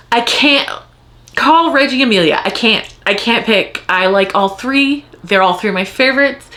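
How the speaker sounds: normal talking voice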